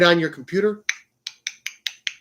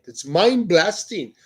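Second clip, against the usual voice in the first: slow put-on Indian accent; imitated Indian accent; 3 semitones higher, 3 dB louder, slower than usual. Defending the friends' phrase, slow imitated Indian accent. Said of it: "No, that's how they say it".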